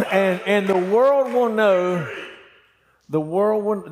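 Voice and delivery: slow, high, ringing declaration; drawn-out triumphant preaching; preacher's proclamation. Retold or imitated. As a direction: proclaim it to the whole congregation. Preacher proclaims David's climactic declaration.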